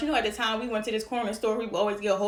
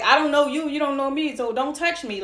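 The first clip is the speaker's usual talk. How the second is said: curt, higher-pitched brush-off; mean; 4 semitones higher than usual. Retold or imitated. Girl warding off a boy in the hallway, mean. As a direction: tell them off, mean and dismissive. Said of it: "Like, I was mean".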